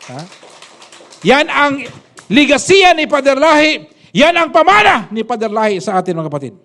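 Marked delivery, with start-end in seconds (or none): 1.3-6.2 full-force preaching. Acted. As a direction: preach it to the whole congregation. Preaching climax about the legacy.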